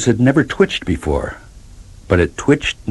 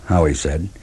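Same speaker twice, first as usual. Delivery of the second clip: plain reading voice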